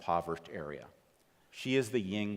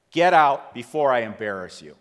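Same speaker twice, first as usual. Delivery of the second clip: much louder, hard threatening order; menacing threat; 11 dB louder than usual. Retold or imitated. Dealer threatens the speaker on the way out, loud and menacing. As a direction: throw them out with a threat. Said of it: "this is what he said to me".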